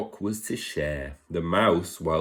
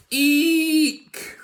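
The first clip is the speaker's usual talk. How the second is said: a tiny high shriek, much louder; high squeaky eek; 6 dB louder than usual. Acted. Lion trying to roar, high-pitched eek.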